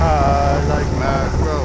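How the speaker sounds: drawn-out playful chant; lazy singsong line; slow sing-song. Pretend. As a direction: stretch out I like mackerel slowly.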